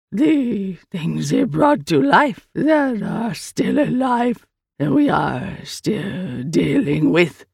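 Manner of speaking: very slow, stretched alien speech; strange creature, grave. Acted. The Eye tells Sal about the war, slow creature voice. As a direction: weigh out each word as an otherworldly being.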